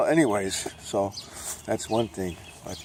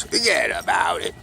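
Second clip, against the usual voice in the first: Brooklyn catchphrase; 3 dB louder than usual. Acted. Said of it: "I say".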